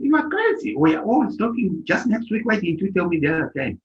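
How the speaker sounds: high-pitched disbelief at a friend; retold incredulous scolding. Speaker scolds cousin for hiding his move, imitated incredulous reproach.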